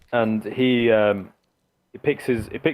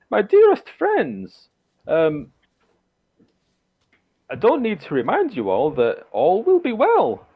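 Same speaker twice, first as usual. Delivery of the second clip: much higher, slow, grand lady's toast; Baroness voice; 9 semitones higher, 5 dB louder, slower than usual. Acted. Baroness toasting her guests, high grand voice. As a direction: lift it high and theatrical like a hostess. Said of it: "and she says".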